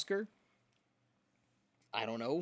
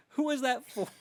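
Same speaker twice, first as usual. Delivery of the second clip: much higher squeaky repeat; squeaky disbelief; 13 semitones higher, 6 dB louder than usual. Shown as a demonstration.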